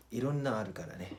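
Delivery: normal talking voice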